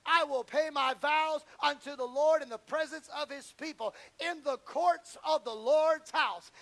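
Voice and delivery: psalm read rising to a loud slow shout; preached scripture swelling to a shout; building psalm reading. Acted. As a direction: build the scripture up to a cry of praise.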